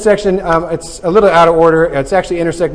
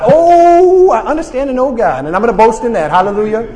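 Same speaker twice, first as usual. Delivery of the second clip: mock party joy; 6 semitones higher, 4 dB louder than usual. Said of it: "You just wanna have a little party".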